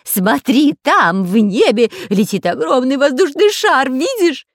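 high, loud cry of discovery; joyful high-pitched yelling; excited shouting. A narrator's reading. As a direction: call out thrilled, urging a look. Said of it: "закричал Дырка".

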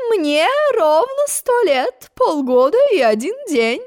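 high, slow proud queen; a hint of a vain old queen; lightly voiced queen. A narrator's reading. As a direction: announce it grandly. Queen stating her age.

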